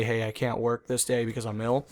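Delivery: ordinary talk, nothing marked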